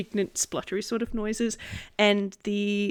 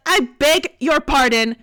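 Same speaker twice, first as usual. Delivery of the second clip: huffy and affronted, a bit louder; indignant; 4 semitones higher, 7 dB louder than usual. Acted. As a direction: bristle with offended pride. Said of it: "puff up indignantly".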